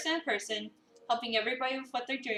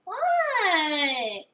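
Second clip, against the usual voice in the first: innocent what; 5 semitones higher, 5 dB louder than usual. Acted. Finley caught in the act.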